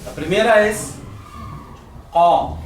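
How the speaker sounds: qa from the back of the throat, mouth full; a throaty Arabic qaf; deep qa. Shown as a demonstration. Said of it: "la boca llena".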